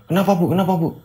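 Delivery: high, urgent repeated question; worried and rushing; husband running in, alarmed. Acted. Husband rushes to kitchen alarmed.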